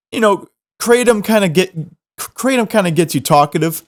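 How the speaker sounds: normal talking voice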